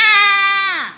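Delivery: short high mock scream of fright. Shown as a demonstration. Teacher showing a scream at a cockroach, sharp high yelp. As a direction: yelp as if you just saw a lizard. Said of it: "make a big sound".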